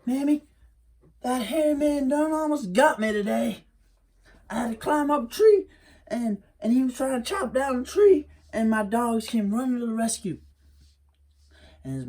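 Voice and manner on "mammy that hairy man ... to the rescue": put-on much higher, breathless boy telling his mother; a boy rushing home with big news; Wiley telling Mammy about his escape, high and quick; excited young Wiley.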